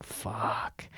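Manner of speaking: long, whispered curse; very soft, voiceless sigh of a word; defeated whisper. Retold or imitated. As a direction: breathe out the swear in retold despair. Retold waking too early.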